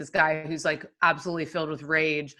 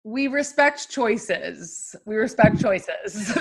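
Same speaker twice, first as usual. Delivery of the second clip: slow, drawn-out declaration; deliberately measured; 2 semitones higher, much slower than usual. Real, not acted. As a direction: stretch the words out for comic tact. Tactful joke about dubious film choices.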